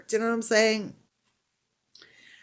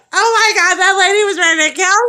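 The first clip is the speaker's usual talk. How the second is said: much higher and louder, shocked gossip; high, loud shocked gossip retold; excited exclamation; 11 semitones higher, 9 dB louder than usual. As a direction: blurt it out, amazed. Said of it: "she's like".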